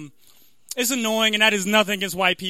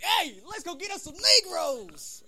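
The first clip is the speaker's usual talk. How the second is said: high, soft, slow mock drawl; Klansman caricature; 9 semitones higher, 6 dB quieter, slower than usual. Klansman rallying his buddies, hammed-up high voice. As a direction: voice an eager Klansman, stretched out. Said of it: "Like the K K K is like".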